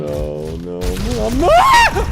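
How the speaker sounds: frantic high-pitched cry of denial; panicked rising yell. Real, not acted. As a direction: voice jumps high and loud as he panics. About to die in game.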